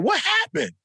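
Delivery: startled and concerned; alarmed question. Retold or imitated. Father asking crying daughter, alarmed and high. Dad sees daughter in tears.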